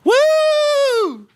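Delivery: high whooping mock cheer; big drawn-out "woo" in a whoop. Mimicking Luffy's careless cheer after Mary's death, high whoop.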